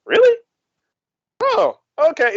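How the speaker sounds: very high-pitched mock reaction; exaggerated high 'oh, really?' tone; retold surprise. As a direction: imitate someone politely taken aback. Imitates others' reaction to news.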